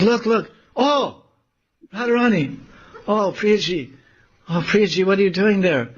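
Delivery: high, exclaiming voice; acted alarm. Lalita pointing out Radharani in the tree, high alarmed cries.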